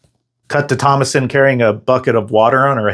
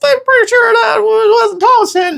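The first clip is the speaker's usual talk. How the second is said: guilty squeak; 3 dB louder than usual. Acted. Guilty dad and son squeak.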